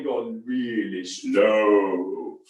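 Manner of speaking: drawn right out, low and slow. Shown as a demonstration. Coach demonstrating the slow first part, very slow. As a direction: stretch every word to show the slow tempo. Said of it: "we do the last bit a little bit quicker".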